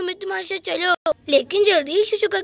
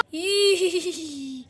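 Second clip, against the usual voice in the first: ghostly laugh; 3 semitones lower, 4 dB quieter than usual. Acted. Ghost laugh after fleeing.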